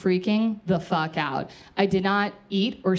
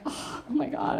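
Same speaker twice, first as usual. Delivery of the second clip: breathy, higher, softer gasp of emotion; mock tearful whisper; 7 semitones higher, 6 dB quieter than usual. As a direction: whisper it as if choked up. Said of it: "I'm crying".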